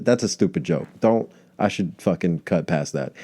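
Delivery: ordinary talk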